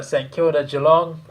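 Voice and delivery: ordinary talk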